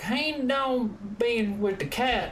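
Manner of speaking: high, very slow mumbled Southern drawl; heavy Southern drawl. Retold or imitated. Imitating Georgia neighbors like Boomhauer, heavy Southern drawl. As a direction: drag it out like a barely intelligible Southern neighbor. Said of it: "He's got, like, that heavy drawl".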